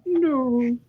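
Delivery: high, drawn-out little wail; mock dismayed no. Viewer recalling seeing him on the Empire's side, mock dismay. Retelling own dismayed reaction to show.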